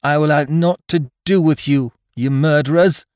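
high, loud, defiant lass; ringing, high-pitched refusal; speaking out bravely. A narrator's reading. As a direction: defy the pirates at full voice. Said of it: "she spoke out bravely".

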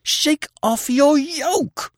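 high, slow, fierce command; fierce cry. Acted. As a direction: fling it at him in fury. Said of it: "she cried fiercely".

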